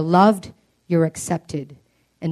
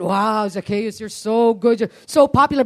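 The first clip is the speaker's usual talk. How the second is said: mock flattery; 3 semitones higher than usual. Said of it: "Maybe they said".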